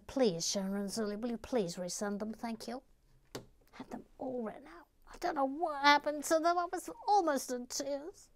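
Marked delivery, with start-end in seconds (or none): 3.7-8.3 high-pitched plaintive fretting; exaggerated upset, pitched up; mock tearful lament. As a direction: wail lightly over lost work. Lamenting lost names.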